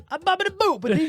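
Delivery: high playful mock-Italian singsong; fake foreign language. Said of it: "That's not Italian".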